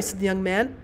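plain reading voice

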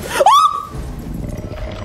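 high, loud startled gasp; real high-pitched gasp of panic; panicked gasp. Real, not acted. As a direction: gasp sharply in fright. Sudden danger in the game.

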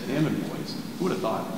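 ordinary delivery

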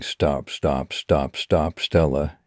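usual speaking voice

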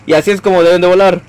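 tutorial narration, step by step; mock tutorial voice. Shown as a demonstration. Playful how-to for viewers on flying. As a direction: announce each step like a teacher. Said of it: "Acabó el tutorial".

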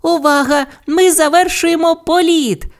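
very high shouted announcement; high-pitched excited crew call. A narrator's reading. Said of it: "прокричала Бу".

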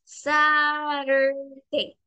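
Saturday drawn out very slowly, first vowel stretched; teacher models Saturday slowly for the class, stretching each sound; slow model word. Shown as a demonstration. Teacher to online class: Saturday, slow and stretched. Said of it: "En el caso de la vocal A en Saturday".

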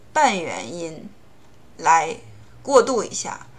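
ordinary talk

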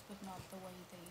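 quiet aside trailing off into a whisper; fading to a whisper. Real, not acted. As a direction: voice drops away into an unvoiced whisper.

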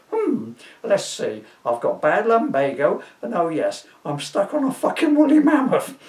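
high, put-on posh man; clipped upper-class gentleman. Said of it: "he replied in his posh voice".

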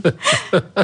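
playful possessive chuckle; low teasing laugh. Acted. Laughs, claiming him.

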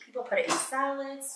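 usual speaking voice

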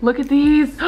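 excited squeal. Real, not acted. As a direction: gush over the nails. Sees her logo on nails.

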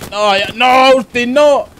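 high, loud cry of protest; desperate shout. Real, not acted. Commentator begging Austin to stop, desperate shout. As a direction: yell it up high, climbing in alarm. Calling out to player in danger.

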